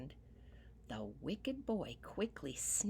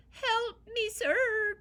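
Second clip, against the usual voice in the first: very high, loud pleading cry; crying little man; 10 dB louder, much slower than usual. Acted. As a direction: squeal for help like a tiny man in pain. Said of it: "cried the little man".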